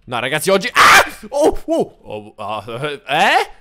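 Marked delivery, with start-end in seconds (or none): 0.7-2.0 loud yelp rising to high cries; startled yell then high whimpers; mock shout. Player suddenly transformed, loud startled yelp then high cries. Skin change hits him.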